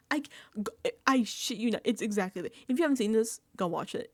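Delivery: usual speaking voice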